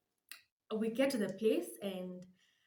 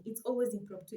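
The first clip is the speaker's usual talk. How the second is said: normal talking voice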